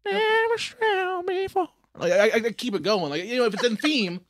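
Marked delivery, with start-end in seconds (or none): 0.0-1.7 put-on high slow old man warble; drawn-out creepy old man; Herbert voice. Shown as a demonstration. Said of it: "what he does".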